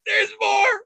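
very high squeal of a reveal; mock toddler triumph; gleeful toddler. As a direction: squeak it like a proud little kid.

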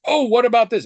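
high, loud, chirpy and clueless; bright oblivious question. Retold or imitated. Customer chirps up at the pharmacist, high and loud, oblivious. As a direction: pop the question up high and loud, cheerfully unaware.

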